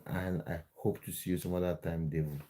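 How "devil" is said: ordinary talk, nothing marked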